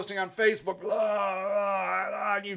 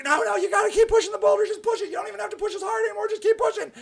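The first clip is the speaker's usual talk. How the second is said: much higher, fast coaxing cheer; cheerleader voice; 12 semitones higher, much faster than usual. Retold or imitated. Marketer cheering the busy client on, high and fast. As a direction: cheer them on like a peppy coach. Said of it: "I'm like".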